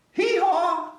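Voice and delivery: high, loud braying hee-haw; donkey bray. Acted. Reading to children, loud high donkey hee-haw.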